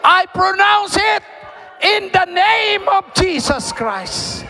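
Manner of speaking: high, forceful proclamation with drawn-out endings; full-force prayer preaching. Real, not acted. Preacher pronouncing healing and power over the church, high forceful shout.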